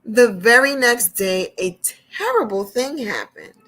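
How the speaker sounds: gentle storyteller reading to little ones; storybook reading. Acted. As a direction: read it like a picture book to kids. Reads storybook to children.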